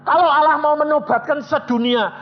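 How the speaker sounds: high, loud pulpit preaching; fiery sermon voice; full-force preaching. Acted. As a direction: preach it hard to the whole room.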